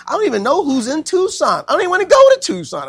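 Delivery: high, loud, fast anxious pleading; rising distress. Retold or imitated. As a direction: voice climbing in panic about moving. Preacher voicing his younger self's distress.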